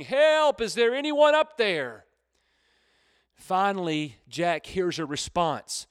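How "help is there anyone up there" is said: acted high, loud cry for help; yelling for help. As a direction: shout up to whoever might hear. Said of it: "And he's yelling".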